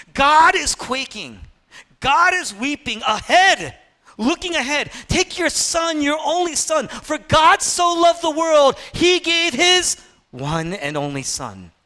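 high, loud, impassioned proclamation; fervent preaching. Real, not acted. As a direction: build into a slow, ringing declaration. Climax on God's agony, preached fervently.

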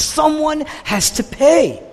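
high, slow, drawn-out demand; conflicted insistence. Retold or imitated. Preacher as Jonah struggling with God's mercy, conflicted demand. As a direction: stretch it out as an insistent protest. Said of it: "And he's conflicted".

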